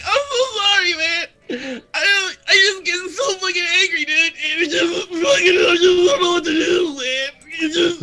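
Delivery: loud, high, blubbering confession; hammed-up tearful breakdown; crying apology. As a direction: wail it out mid-hug, falling apart. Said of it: "starts to cry".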